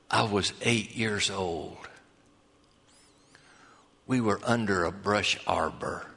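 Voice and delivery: barely able to get the words out; frail weak voice. Retold or imitated. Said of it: "very frail, weak voice".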